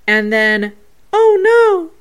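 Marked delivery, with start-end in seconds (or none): 1.1-1.9 high, loud mimicked boy's cry; imitating Jacob. Reading out spirit boy's words for viewers, high imitation. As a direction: mimic a little boy's dismay, pitched way up. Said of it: "but from Jacob".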